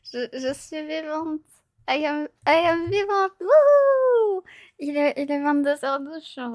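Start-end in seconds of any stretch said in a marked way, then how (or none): 1.9-4.4 excited cheer. Acted. Mock English announcement, cheer.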